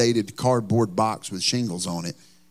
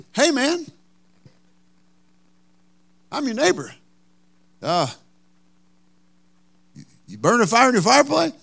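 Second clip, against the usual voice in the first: neighbor voiced higher, quick on the last question; neighbor's voice; 10 semitones higher than usual. Neighbor Ken at the front door, voiced higher. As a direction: play the curious neighbor at the door.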